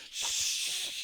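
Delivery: sharp whispered shh; shushing hiss. Pretend. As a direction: hush the men. Hushing in acted raid.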